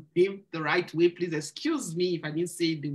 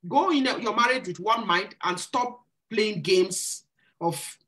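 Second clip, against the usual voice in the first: ordinary delivery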